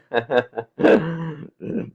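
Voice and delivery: light laugh after a greeting. Real, not acted. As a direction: chuckle freely after saying hello. (risada espontânea)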